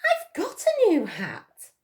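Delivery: high, slow, proud little announcement; Missus Honey voice. Acted. Missus Honey tells everyone about her new hat, high and slow. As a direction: show off the hat in a lightly voiced lady.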